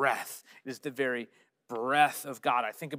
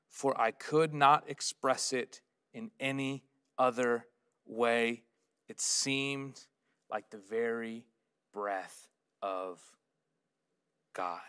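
reverent quotation; 5 semitones lower, slower than usual. Acted. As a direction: let each phrase settle into a hush.

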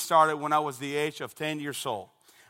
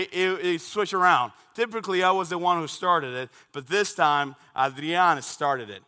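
ordinary delivery

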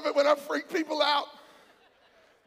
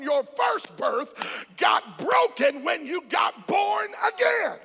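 rousing, driving sermon delivery; full-force preaching; 4 semitones higher, much slower than usual. Real, not acted. Preacher urging the church to praise God, full-force preaching. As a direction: preach it out to a congregation.

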